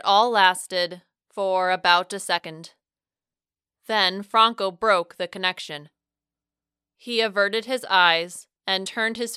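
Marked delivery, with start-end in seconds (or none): none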